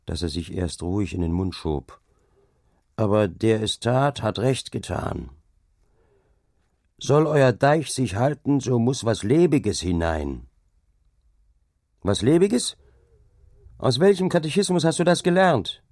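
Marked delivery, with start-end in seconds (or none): 3.0-10.5 slow, measured insolence; deliberate defiance. A narrator's reading. Carter lectures the dike master on superstition, deliberate defiance. 12.0-15.8 high-pitched, incredulous challenge; indignant questioning. A narrator's reading. Hauke challenges the carter's heathen belief, indignant.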